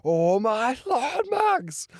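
mock yelp, high on Max; imitating radio excitement. Vlogger quoting Alex Albon's radio, high excited mimicry. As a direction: echo the driver's radio cry. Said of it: "Alex Albon on the radio".

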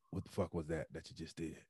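a low rough demand; raspy Mike Anderson. Retold or imitated. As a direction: veteran questioning a rookie, mild low rasp. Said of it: "he got this real raspy voice".

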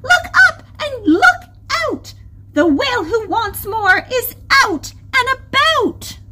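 loud, high-pitched crab alarm; shouted warning. A narrator's reading. Crystal warns the reef about the whale, shouting. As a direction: call the danger out to the whole reef. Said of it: "Crystal shouted".

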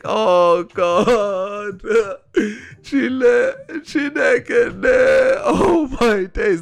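high-pitched crying laughter; cracking up, half laughing half wailing; laughing hysterically. Real, not acted. Reacting to a savage comment.

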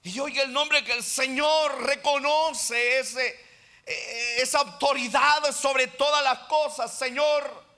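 loud, high fervent sermon delivery; full-force preaching. Real, not acted. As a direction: proclaim it from the pulpit, pressing every phrase.

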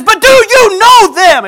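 high, slow, very loud insistence; screaming demand. Retold or imitated. Husband pressing his wife about strangers, screaming. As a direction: yell the question, each word stretched. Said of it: "they scream at each other for a second".

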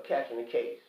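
ordinary talk

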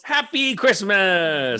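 high, loud, drawn-out holiday cheer; hammed-up Christmas holler; big festive greeting. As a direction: belt out the greeting with stretched syllables. Mock-cheery holiday greeting called out.

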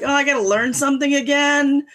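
put-on sulky grumbling kid; whiny grumble. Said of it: "I'm not thrilled because it's like".

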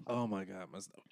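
worn out and quiet from laughing; after laughing hard. Real, not acted. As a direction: gasp it out, spent from laughter. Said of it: "I'm dying in here".

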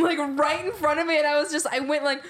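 ordinary delivery

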